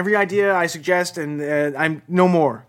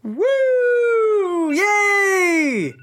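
high cheering; new year countdown crowd; 12 semitones higher than usual. Pretend. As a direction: cheer like the clock struck midnight.

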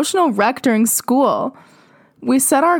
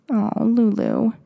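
pitying sigh; slower than usual. Real, not acted. Pitying aside to the character.

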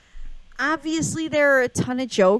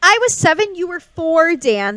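loud; 8 semitones higher, 8 dB louder than usual. Real, not acted. Teasing argument about ages.

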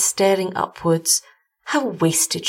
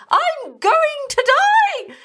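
very high, slow, louder wail of despair; despairing cry; 3 dB louder, much slower than usual. A narrator's reading. Bitten wife cries to her husband, high despairing wail. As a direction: wail it out, sure of death.

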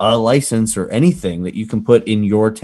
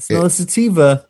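mock sniffing cop; 9 semitones higher than usual. Mocking the cop sniffing weed strains.

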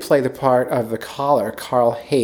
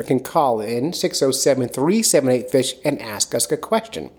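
ordinary delivery

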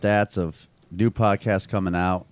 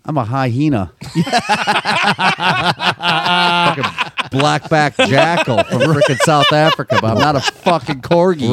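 laughing; 5 semitones higher, 4 dB louder than usual. Real, not acted. Laughing while protesting.

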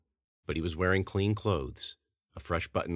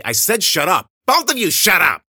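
screaming, much higher and louder; furious shout; 14 semitones higher, 13 dB louder than usual. Acted. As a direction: lose it and yell at them both. Girl yells at the others.

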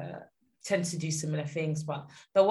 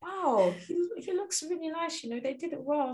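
higher-pitched impression of an impressed mum; admiring mum; 9 semitones higher, 3 dB quieter than usual. Daughter mimicking mum at grandpa's open casket, admiring. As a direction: coo over how well it turned out, imitating mum. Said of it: "she was like".

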